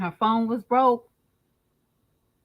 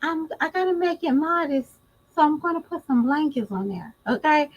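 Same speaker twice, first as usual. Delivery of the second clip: a higher mock voice, lightly done; mimicking Jazz; 6 semitones higher, faster than usual. Mocking her modest wish list request, higher mock voice. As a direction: lift the voice to imitate her again. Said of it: "And she's like".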